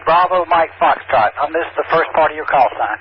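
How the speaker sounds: ordinary talk, nothing marked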